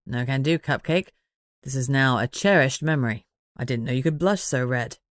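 lightly voiced teasing man; Manners, teasing. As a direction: tease her, pitched low and easy.

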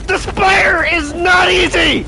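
loud exasperated yell at the game; real frustrated gamer shout. Real, not acted. Repeats the shout.

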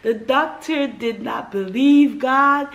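choked with emotion; tearful, slowed speech; teary. Real, not acted. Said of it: "I was literally crying".

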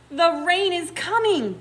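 high, loud storybook cry. A narrator's reading. Baboons announcing the coming rain, crying out. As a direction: call it out like excited baboons. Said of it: "cried the baboons".

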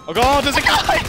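high loud startled cry; startled shout. Real, not acted. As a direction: yelp at a sudden enemy. Surprised by enemy.

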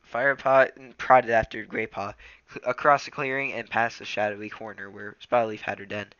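ordinary delivery

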